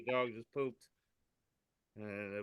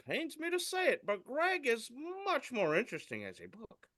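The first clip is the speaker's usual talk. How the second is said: mock tree voice; 12 semitones higher than usual. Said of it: "he says".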